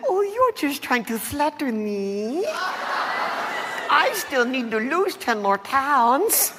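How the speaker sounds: high, slow, drawn-out coyness; flattered diva. Acted. Vain skeleton who feels flattered, coy diva.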